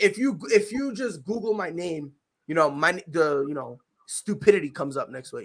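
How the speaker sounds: usual speaking voice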